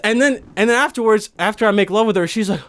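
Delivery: ordinary delivery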